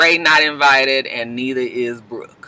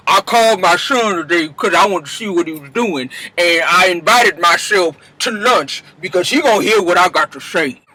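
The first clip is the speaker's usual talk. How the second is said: a lightly put-on mother's voice, firm; Mama Dap voice. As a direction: voice her like a mother set on making her point. Mama Dap arrives at lunch.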